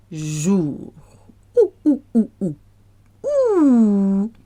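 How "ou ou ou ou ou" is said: high monkey hoots, last one held; short high oos, then a long one. Shown as a demonstration. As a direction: hoot "ou" like a monkey. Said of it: "like a monkey".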